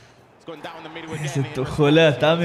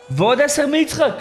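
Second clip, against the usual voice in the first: thrilled announcement; 10 semitones higher than usual. Real, not acted. Announcing another gift, thrilled.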